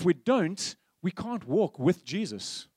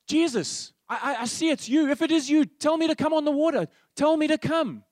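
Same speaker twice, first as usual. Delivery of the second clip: raised, pleading call across the water; Peter's eager plea; 8 semitones higher, 3 dB louder than usual. Retold or imitated. Peter calling to Jesus from the boat, high eager plea. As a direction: beg to be called out onto the lake.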